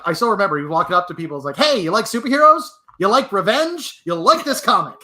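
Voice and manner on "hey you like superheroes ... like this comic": loud, high hard-sell pitch; carnival barker voice. Retold or imitated. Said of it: "he didn't actually have my, uh, like, Carnival Barker voice".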